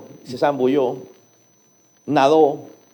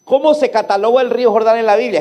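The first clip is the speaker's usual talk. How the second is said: ordinary delivery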